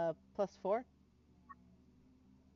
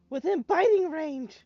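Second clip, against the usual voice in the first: excited gasp; 11 semitones higher, 10 dB louder, slower than usual. Real, not acted. Gasps at monster in range.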